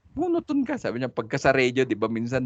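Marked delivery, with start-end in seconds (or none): 0.1-0.8 wife's remark. Retold or imitated. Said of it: "siya yung magsasabi".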